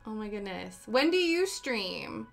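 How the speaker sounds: ordinary talk, nothing marked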